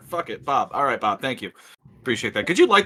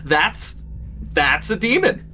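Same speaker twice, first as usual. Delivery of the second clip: high, fast and eager; higher and brighter, rushing the words; excited reminder; 8 semitones higher than usual. Acted. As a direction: urge him to remember, all worked up. Player excitedly reminding about summoning.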